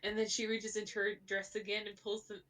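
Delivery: usual speaking voice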